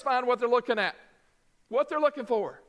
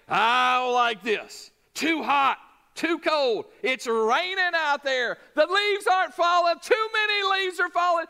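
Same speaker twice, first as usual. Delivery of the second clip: whiny griping list, rising to a shout; mock complaining; 6 semitones higher than usual. A preacher mocking his grumbling congregation, building to a shout. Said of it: "We're good at complaining".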